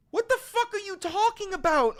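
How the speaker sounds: high, loud exasperated question; incredulous high shout. Real, not acted. Vlogger yelling at Trump in exasperation. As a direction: throw it up high, fed up.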